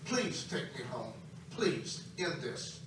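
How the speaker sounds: soft, broken begging for release; retold crying plea. Preacher retelling his plea to Jesus, softly broken. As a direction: plead to God, hushed and worn out. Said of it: "I'm crying like crazy".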